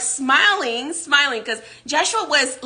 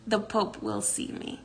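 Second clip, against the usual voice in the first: "The Pope will see me" repeated much softer, settled; calm, softer; 10 dB quieter than usual. Retold or imitated. Joshua repeating to the guard, softer and certain. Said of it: "Joshua very calmly smiles at him".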